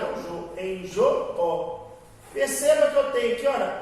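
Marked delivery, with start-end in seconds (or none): 0.0-1.9 slow, leaning on "jo" in "enjoo"; stressed syllable demo. Shown as a demonstration. Teacher shows students the stressed "jo" in "enjoo", slow and deliberate. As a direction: stretch out "é o jo, enjoo", punching "jo". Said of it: "pronunciada com intensidade".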